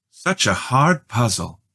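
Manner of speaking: put-on old guest chatting to the children; older lady. A narrator's reading. Mrs Budwing speaks to children.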